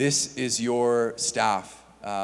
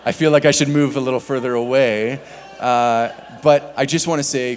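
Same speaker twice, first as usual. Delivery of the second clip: much louder, higher, joking; 4 semitones higher, 11 dB louder than usual. Real, not acted. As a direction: raise the voice playfully. Jokes about the strong legs.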